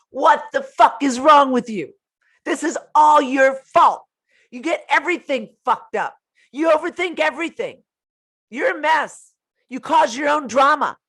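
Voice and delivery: high, loud blaming tirade; acting out someone tearing into a partner; abusive angry yelling. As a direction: berate them, punching each accusation. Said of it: "talks to you that way".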